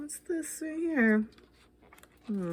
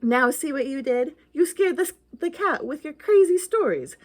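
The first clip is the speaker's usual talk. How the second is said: ordinary delivery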